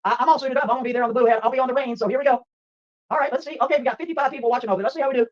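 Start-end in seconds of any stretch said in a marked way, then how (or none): none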